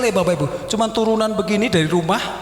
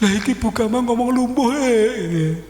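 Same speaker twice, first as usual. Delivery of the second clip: mock whining; 5 semitones higher than usual. He whines back to wife.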